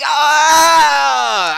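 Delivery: long, loud, high groan of frustration; drawn-out "aaah" at full voice; exasperated yell. Acted. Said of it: "And he's like".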